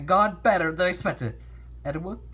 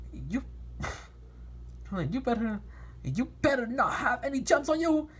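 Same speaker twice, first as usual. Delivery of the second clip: exclaiming; 6 semitones higher, 3 dB quieter than usual. Acted. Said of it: "he exclaimed".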